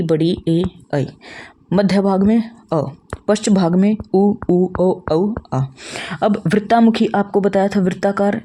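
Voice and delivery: ordinary talk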